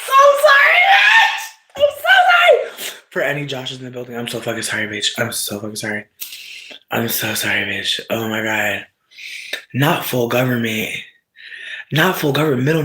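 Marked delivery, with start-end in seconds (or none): 0.0-3.0 very high, loud squealed apology; over-the-top screamed sorry; shrieked apology. Real, not acted. Comic outburst of apology after Josh. 3.1-8.0 hushed sorry after an outburst; subdued apology. Real, not acted. Calmer apology to any Joshes.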